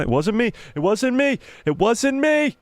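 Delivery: playful protest that soars up and holds the last note; mock-innocent denial. Joking denial in rising singsong.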